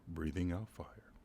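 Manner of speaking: ordinary delivery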